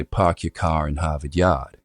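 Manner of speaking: slow New York-style dropped R's; non-rhotic East Coast demo line; non-rhotic New England accent. Shown as a demonstration. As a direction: drop every R, open back "ah" vowels. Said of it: "I'd say".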